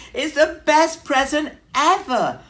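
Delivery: high, loud, beaming; proud announcement. A narrator's reading. Bear proudly announces Mouse's present to everyone, high and loud. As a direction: announce it with pride. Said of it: "Bear announced proudly".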